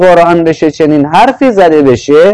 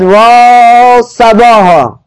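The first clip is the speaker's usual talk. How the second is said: alarm cry; 6 semitones higher, much slower than usual. Retold or imitated. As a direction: call out the warning slowly, like a siren. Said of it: "ایستاد و فریاد زد".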